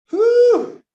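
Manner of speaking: high, loud exhaled whew of real breathlessness; breathless whew. Real, not acted. Teacher out of breath, letting out a loud whew. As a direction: blow it out like you're winded. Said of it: "'cause I'm out of breath".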